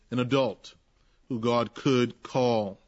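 ordinary talk, nothing marked

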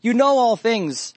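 high, slow, drawn-out plea; heart cry. Acted. Peter to the Lord, a slow high heart cry. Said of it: "same heart cry that's coming from Peter".

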